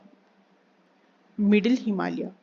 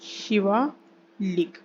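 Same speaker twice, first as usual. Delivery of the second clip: Shivalik drawn out, split before लिक; slow dictation; much slower than usual. Shown as a demonstration. Teacher repeats the range's name for students, Shivalik slowly in two parts. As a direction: say Shivalik very slowly, pausing before the last syllable.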